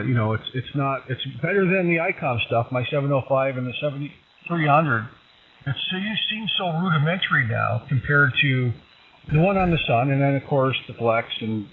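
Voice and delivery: ordinary delivery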